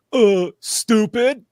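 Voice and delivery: high, slow, dim-witted starfish voice; slow, dumb cartoon sidekick answer; dopey cartoon voice. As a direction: drag it out like a dopey friend. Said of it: "And he goes".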